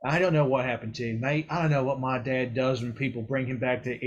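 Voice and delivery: put-on blunt, teasing old figure explaining death; Mor'du. Mor'du explaining to the hero that he died, teasing. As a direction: lean in and lecture with cheeky bluntness.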